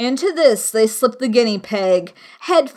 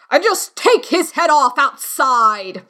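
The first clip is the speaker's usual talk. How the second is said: higher, louder queen's order; 5 semitones higher, 4 dB louder than usual. Acted. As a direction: bark it like a haughty queen.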